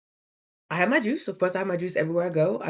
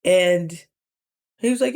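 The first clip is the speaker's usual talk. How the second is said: ordinary talk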